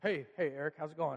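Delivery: retelling a brave front over crying; trying to sound fine after crying; covering up tears. Retold or imitated. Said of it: "I try to cover over the fact that I've been crying".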